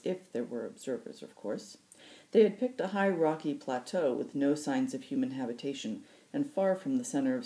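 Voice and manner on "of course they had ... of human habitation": ordinary delivery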